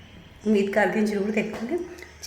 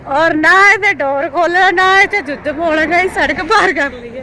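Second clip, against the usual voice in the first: higher and louder, listing every chore; loud ranting list; 6 semitones higher, 9 dB louder than usual. Real, not acted. Vlogger telling viewers about endless chores in India, raised voice. Rant resumes after a breath.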